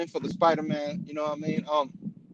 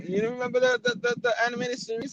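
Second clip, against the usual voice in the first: ordinary delivery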